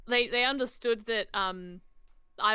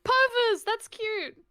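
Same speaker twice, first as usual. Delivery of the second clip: cooing; 12 semitones higher, 4 dB louder, much slower than usual. Real, not acted. Cooing over cat name.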